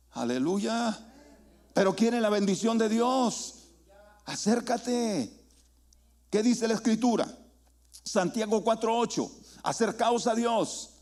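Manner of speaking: high-pitched, urgent call to the congregation; full-force preaching. Real, not acted. Preacher urging people who want a blessing to come closer, forceful preaching.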